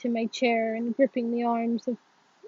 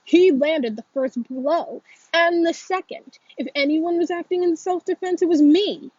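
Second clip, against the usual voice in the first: loud, higher-pitched schoolboy protest; heated, raised-voice self-defense; indignant protest; 5 semitones higher, 6 dB louder than usual. A narrator's reading. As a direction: protest your innocence hotly. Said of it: "Brady protests".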